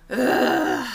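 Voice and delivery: long, disgusted "ugh"; exasperated groan. Real, not acted. Woman ranting about what a mammogram costs, exasperated groan. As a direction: groan it out in frustration.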